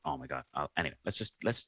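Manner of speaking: ordinary delivery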